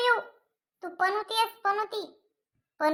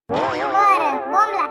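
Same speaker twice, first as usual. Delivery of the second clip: louder, snapping at his son to get on with it; a fed-up father barking out his order; annoyed shout; 5 dB louder, much slower than usual. Acted. Irritated father tells son speak.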